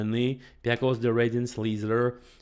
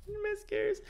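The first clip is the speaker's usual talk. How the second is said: laughing apology; 6 dB quieter than usual. Real, not acted. Laughing again at own pronunciation.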